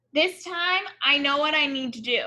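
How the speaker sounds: usual speaking voice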